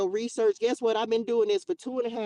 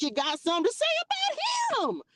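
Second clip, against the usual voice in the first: high sing-song mock; mock the critics sarcastically; high sarcastic jab at the haters. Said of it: "mocking critics".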